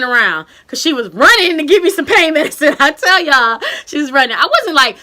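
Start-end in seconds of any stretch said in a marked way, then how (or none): none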